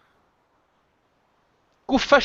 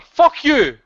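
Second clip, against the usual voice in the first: higher, louder parting yell; furious shout; 6 semitones higher, 4 dB louder than usual. Real, not acted. Host ending his rant at the disloyal caller, shouting. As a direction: spit it out as a final blast. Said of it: "[name]'s rant".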